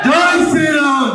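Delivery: high, drawn-out rallying cry; slogan shout. Real, not acted. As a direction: raise the pitch and rouse the crowd. Rousing crowd to answer louder. (పై స్థాయిలో నినాదం అరవడం)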